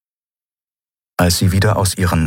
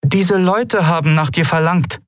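much higher, uneasy telling; intimidated; 11 semitones higher than usual. Acted. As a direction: speak like a scared mother. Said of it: "begann ihre Mutter eingeschüchtert".

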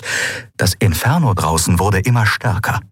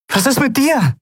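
high voiced worried question; Endriel asking. A narrator's reading. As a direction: turn back and ask in a high voice. Endriel turns back worried.

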